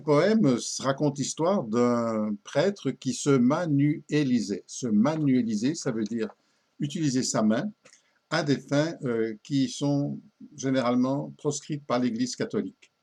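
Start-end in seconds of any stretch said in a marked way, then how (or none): none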